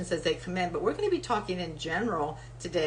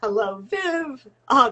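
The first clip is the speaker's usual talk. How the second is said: normal talking voice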